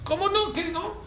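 can't believe his ears; disbelief. Retold or imitated. Brother insisting he has thirteen kids, high disbelief.

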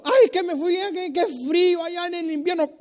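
mock whiny complaint about the cold; whiny complainer. Preacher mocking a homesick complainer, whiny. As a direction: whine like someone moaning about winter.

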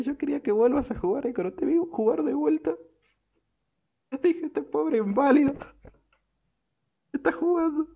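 put-on very high, gushing drunk voice, laughing between lines; drunk sentimental gush. Drunk player tells his old rival he cried for him, high and gushing.

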